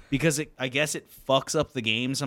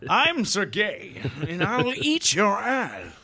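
higher, very slow hammy knight voice; Sergey impression; 8 semitones higher, much slower than usual. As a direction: declaim it like a pompous knight. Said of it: "impersonating Sergey the Russian kid".

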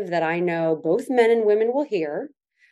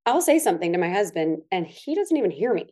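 ordinary talk

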